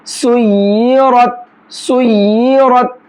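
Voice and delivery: सुय्यरत with a full, heavy ra, drawn out slowly. Shown as a demonstration. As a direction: recite सुय्यरत slowly, thickening the ra. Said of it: "इस तरह से इसके लफ्ज़ को पढ़ना है".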